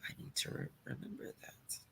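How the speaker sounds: under-the-breath whisper to herself; whispered aside. Real, not acted. Host noting a ticket trick to herself, whispered aside. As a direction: whisper it as a private note to self. Aside after the nurse tip.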